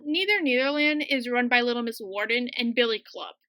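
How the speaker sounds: ordinary talk, nothing marked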